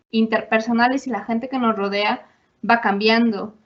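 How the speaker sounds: ordinary talk, nothing marked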